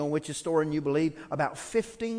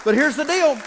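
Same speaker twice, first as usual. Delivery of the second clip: Here's the deal shouted much higher and louder; loud hype; 11 semitones higher, 11 dB louder than usual. Real, not acted. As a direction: fire up the room with a big call. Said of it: "Bump your neighbor and say".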